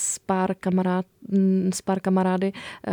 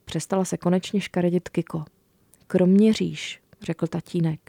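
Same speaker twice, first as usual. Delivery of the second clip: put-on reading a children's book aloud; story narration; 5 dB louder than usual. A narrator's reading. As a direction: tell it warmly to young listeners. Author reads her book aloud.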